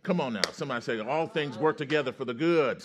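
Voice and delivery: rally the room, stretching the final phrase; full-force preaching. Real, not acted. Preacher has everybody repeat the promise, full-force preaching.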